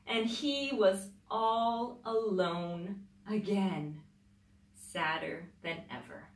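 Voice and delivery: put-on lively read-aloud voice for children; storybook reading. A narrator's reading. Mother reading a picture book to children, lively storybook voice.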